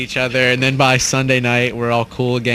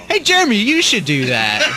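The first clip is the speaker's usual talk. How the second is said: lightly mocking friends; 9 semitones higher than usual. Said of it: "everybody's like".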